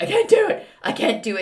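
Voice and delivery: lightly voiced six-year-old, high, hammed-up protest; a hint of a little boy begging to leave; dramatic child. Young son in the shop begging his mom to leave, mock-dramatic. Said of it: "It's very dramatic".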